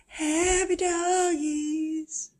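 high, very slow, almost crying with delight. Real, not acted. Baby talk to the happy dogs, high and slow.

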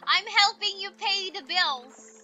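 loud, insistent plea to a parent; protesting to father. Pretend. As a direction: argue your case to father.

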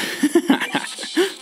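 high breathy giggle; high giggle at losing; high laugh. Real, not acted. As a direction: burst out in a high airy laugh. Laughs at own failure.

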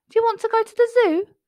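father offers the zoo trip, high and bright; excited offer. A narrator's reading. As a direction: surprise her with a treat.

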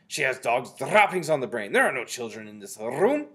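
loud, scornful witch leader; shouted. A narrator's reading. Grand High Witch dismissing Mildred's claim, shouted. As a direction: shout it down emphatically, rolling the R. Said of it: "shouted the Grand High Witch".

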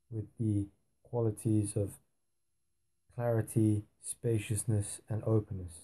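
soft, unhurried meditation voice with long pauses; guided meditation. Acted. Teacher leading listeners into a blue-sky meditation, softly. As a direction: guide them gently into stillness, hushed and spacious.

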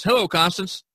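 usual speaking voice

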